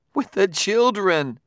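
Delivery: slow, high and scornful; scornful woman. A narrator's reading. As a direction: drawl it with disdain. Clarissa's scornful reply.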